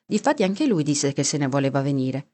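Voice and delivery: ordinary delivery, nothing marked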